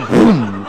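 vocal boom of a shotgun; imitated gunshot. Gaucho firing beside the horse, imitated bang. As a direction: boom it out like a blast.

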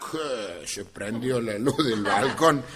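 ordinary delivery

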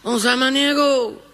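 put-on high, slow country call of a sick old man; calling from the gate. Florentín calls to healer from the tranquera, high drawn-out hail. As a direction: call out from the gate toward the house. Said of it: "Y golpeó".

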